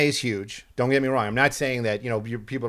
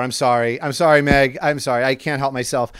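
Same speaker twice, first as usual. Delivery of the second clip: ordinary delivery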